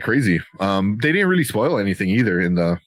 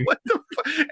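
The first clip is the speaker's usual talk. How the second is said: incredulous disbelief. Retold or imitated. Acted disbelief at movie scene.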